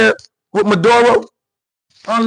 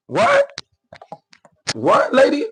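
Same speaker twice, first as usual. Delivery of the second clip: incredulous what; 11 semitones higher, 4 dB quieter, slower than usual. Real, not acted. Reacting in disbelief to clip.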